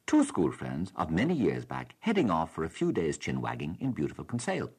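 normal talking voice

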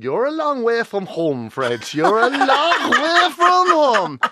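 hammed-up Kerry accent, high, loud and slow; Jackie Healy-Rae. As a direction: draw it out grandly in a thick Kerry accent, laughter creeping in. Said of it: "starting to sound like Jackie Healy-Rae".

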